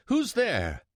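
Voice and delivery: high startled cry in the dark; frightened cry. Acted. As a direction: cry out suddenly, scared. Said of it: "crying out".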